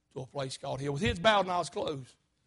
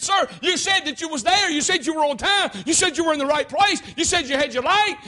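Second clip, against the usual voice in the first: high, loud recounting of testimony; incredulous cross-examination; 7 semitones higher, 6 dB louder than usual. Acted. Lawyer confronting the watchman with his testimony, forceful challenge. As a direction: challenge him point by point.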